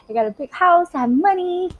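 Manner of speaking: mock glee. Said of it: "if you like".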